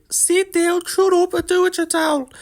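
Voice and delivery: squeaky high parody of a bossy fighter; high mocking impression. Mocking Ian Garry's orders in a squeaky high voice.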